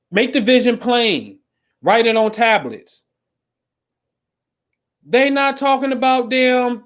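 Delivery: high-pitched preacher's cry; preaching. Acted. Preaches from a Bible verse.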